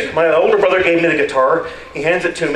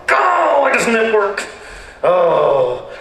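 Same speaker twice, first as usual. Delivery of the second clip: frustrated whine; 8 semitones higher, 3 dB louder, much slower than usual. Retold or imitated. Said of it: "I'm, like, walking around".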